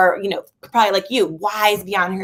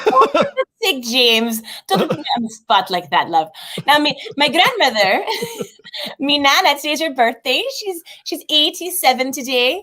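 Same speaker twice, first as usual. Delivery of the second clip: light Irish brogue, a bit higher; 6 semitones higher than usual. Pretend. James asks for a brogue, she answers in a playful Irish accent. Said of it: "can you put on a good brogue".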